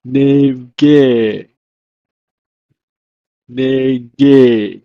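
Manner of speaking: "nege" in two slow, distinct syllables; Hebrew pronunciation demo. Shown as a demonstration. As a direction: draw out both halves of "nege", falling at the end. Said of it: "is pronounced as".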